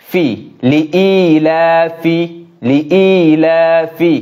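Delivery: লিইলাফি slow, with a long ii and a clean f; লিইলাফি drawn out for practice; clear f. Shown as a demonstration. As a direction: read লিইলাফি slowly, teeth on lip. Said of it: "সামনের দাঁত নিচের ঠোঁটের পেটের সঙ্গে লাগবে".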